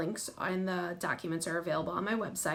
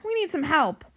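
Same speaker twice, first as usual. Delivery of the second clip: raised, high call for help; storybook yell; 8 semitones higher, 6 dB louder than usual. A narrator's reading. Captain asking the monster for help, high call. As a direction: call over to a friend for help. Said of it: "he yells to the sea monster".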